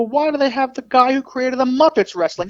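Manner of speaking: high, put-on clueless question; mock bafflement. Feigned confusion over wrestler's name.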